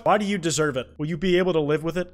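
usual speaking voice

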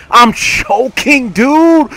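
slow, high, loud outburst; exasperated yell. Real, not acted. Player choking near the kill, exasperated yell. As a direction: stretch it out and yell in frustration.

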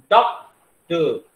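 Doctor in two loud, separate beats; teacher's slow, emphatic split of doctor; syllable break. Shown as a demonstration. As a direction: stop briefly after डॉक in doctor, then give the final syllable. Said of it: "जहां भी थोड़ा सा ठहराव आता है".

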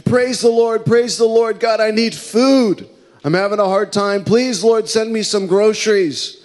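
high pleading cry to God. Retold or imitated. Hungry old lady pleading to God on her porch, high cry. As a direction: beg the Lord from the porch, voice up high. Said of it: "cried out to God".

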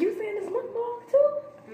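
high, slow incredulous question; surprised. Real, not acted. Teasing disbelief over pronunciation.

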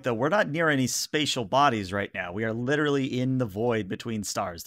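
ordinary talk, nothing marked

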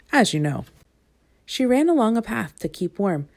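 plain reading voice

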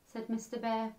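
ordinary delivery, nothing marked

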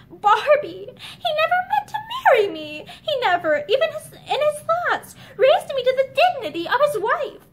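ordinary delivery, nothing marked

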